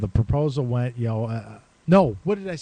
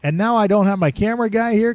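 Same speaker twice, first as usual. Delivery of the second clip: retold exasperated rant, pitched higher; higher, fed-up host; 8 semitones higher than usual. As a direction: play the frantic host whose plan is falling apart. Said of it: "He's like".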